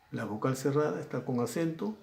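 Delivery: ordinary talk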